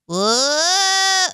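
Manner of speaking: long falling high "Whoa!" like a game bug; cartoon whoa sound. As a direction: imitate a toy creature's cry. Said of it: "Who makes that sound?".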